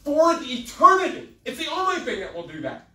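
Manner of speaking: loud, high, urgent sermon delivery; full-force preaching. Real, not acted. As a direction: press every phrase on the congregation with fervor.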